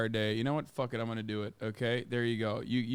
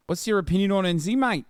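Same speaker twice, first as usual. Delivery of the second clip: put-on Kiwi accent; 4 semitones higher than usual. Streamer mocking a Kiwi chatter, in a Kiwi accent.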